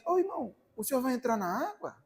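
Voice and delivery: high, mock-sweet sister voice; church sister asking. Preacher imitating the sister at the beach, high-pitched. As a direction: lift the voice up high like the old sister. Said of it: "a irmã diz".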